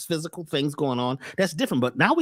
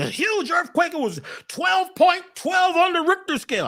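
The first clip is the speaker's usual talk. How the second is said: mock news hype; 11 semitones higher than usual. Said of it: "I'm exaggerating".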